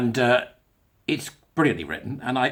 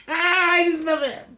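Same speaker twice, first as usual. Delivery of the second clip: very high, drawn-out laughing exclamation; real giggling delight; much slower than usual. Real, not acted. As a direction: squeal it through laughter. Helpless amusement at own quiz.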